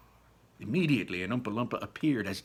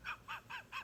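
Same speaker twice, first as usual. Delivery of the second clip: giggle; 15 dB quieter than usual. Acted.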